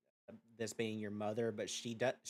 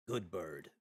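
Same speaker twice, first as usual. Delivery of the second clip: low, slightly distant demon mother; a hint of a low demon mother; 6 semitones lower, 6 dB quieter than usual.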